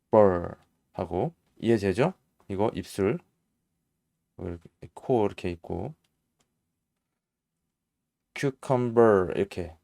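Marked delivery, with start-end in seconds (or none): 0.1-0.5 burr slowly with the tongue rolled back; tongue-curled R. Shown as a demonstration. Teacher explaining tongue curling, drawn-out burr. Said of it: "혀를 이렇게 말아준다고". 8.3-9.3 cucumber slowly, with a curled final R; American cucumber. Shown as a demonstration. Teacher summing up pronunciation, slow cucumber with R. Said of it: "발음을 하는가 봐요".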